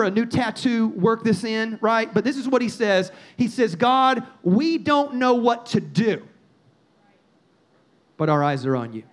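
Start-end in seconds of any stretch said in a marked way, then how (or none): 3.8-6.2 slow, drawn-out declaration; slow pleading prayer. Retold or imitated. Preacher voicing the king's key prayer, slowly and emphatically. 8.2-9.0 much lower, reverent resolve; hushed trust. Retold or imitated. Prayer turns to trust.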